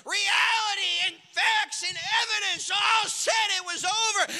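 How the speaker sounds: high, loud, building pulpit shout; rally the congregation at the top of your voice; full-force preaching. Real, not acted.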